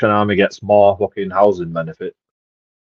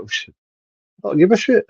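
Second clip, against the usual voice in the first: defiant retort; 10 semitones higher, slower than usual. Retold or imitated. Retorting to staff in story.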